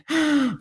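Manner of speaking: sharp high gasp of delight; thrilled gasp; excited gasp. Real, not acted. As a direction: catch your breath in excitement.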